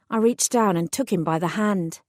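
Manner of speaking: usual speaking voice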